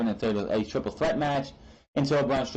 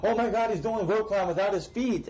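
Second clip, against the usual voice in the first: higher-pitched imitation of an awestruck fan; mock amazement; 8 semitones higher than usual. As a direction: exclaim it like an amazed onlooker. Mimicking an amazed onlooker's reaction.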